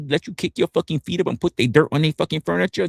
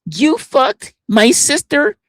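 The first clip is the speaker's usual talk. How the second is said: slow, loud, high-pitched hammed-up outrage; mock outraged accusation; 12 semitones higher, 7 dB louder, slower than usual. Accuser confronting streamer over the family, slow mock shout. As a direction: stretch it out and belt it high.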